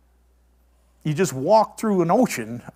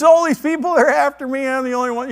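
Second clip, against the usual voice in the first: crying out; 8 semitones higher, 6 dB louder, faster than usual. Retold or imitated. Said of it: "he's crying out".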